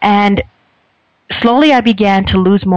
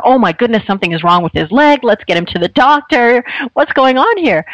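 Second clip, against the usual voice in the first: imitating her own panicky overreaction, higher pitched; mock panic; 5 semitones higher than usual. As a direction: retell the alarm in a higher, fretful voice, half mocking herself. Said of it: "I thought".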